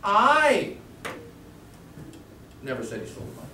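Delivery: "I" shot out high and loud, rest dropped; stress on I. Shown as a demonstration. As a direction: blast the "I", then a pause. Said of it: "seven different meanings".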